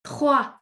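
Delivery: Trois said as twa with no final S; trois, S silent. Shown as a demonstration. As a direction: end Trois on the open wa, no S. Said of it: "We do not pronounce the S".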